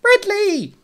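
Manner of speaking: high, loud cartoonish exclamation; squawky puppet greeting in surprise; surprised. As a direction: call the name, taken by surprise. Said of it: "said in a surprised voice".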